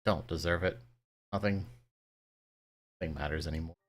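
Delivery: put-on despairing girl. Kaine despairing in tears.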